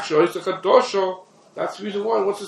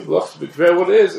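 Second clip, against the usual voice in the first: insistent, louder restatement; louder emphatic repeat; 2 semitones higher, 7 dB louder than usual. Shown as a demonstration. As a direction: say it again, louder and firmer.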